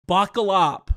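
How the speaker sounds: loud, punchy hype call; hyped announcement. Acted. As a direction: announce it like a big reveal. Hyping up his segue.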